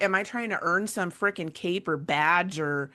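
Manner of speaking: usual speaking voice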